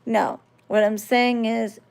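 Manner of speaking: ordinary talk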